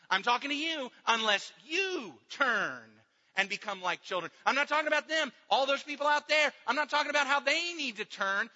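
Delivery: loud, driving sermon delivery; preaching at full force. Acted. Pastor hammering the point to his church, full force preaching. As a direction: press it home to the congregation.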